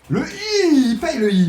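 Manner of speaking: high, loud celebration; drawn-out high cry of triumph; excited cry. Real, not acted. Streamer cheering as the wheel pays the I, excited cry. Wins on letter I.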